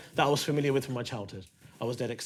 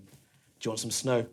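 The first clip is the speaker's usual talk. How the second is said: hushed offer; 7 semitones lower, 7 dB quieter than usual. Retold or imitated. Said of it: "he went".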